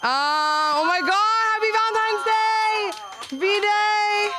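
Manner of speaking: high, slow, squealed happy Valentine's; mock excited greeting. Comedian squealing a holiday greeting at the crowd, high and bright. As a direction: gush it like a cheery greeting card.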